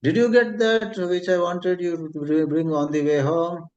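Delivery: a hint of a doting wife who nags; loving nagging wife. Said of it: "very lovingly but a bit naggingly".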